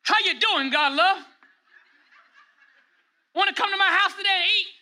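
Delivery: very high-pitched calling voice; comically over-projected invitation at close range; mock overloud greeting. Shown as a demonstration. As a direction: overdo it as if the friend beside you were deaf. Demo of overloud talk up close.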